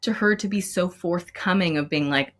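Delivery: ordinary talk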